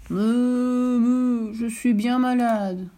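a mooing cow voice, slow and sorry for itself; sick cow lowing. Acted. The sick cow tells the fox she is unwell, mooing slowly. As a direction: moan it out like a poorly cow.

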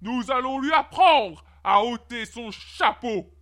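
slow, emphatic royal threat; king's threatening cry. A narrator's reading. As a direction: draw the threat out with menace. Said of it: "cria le roi". (menace royale lente et appuyée)

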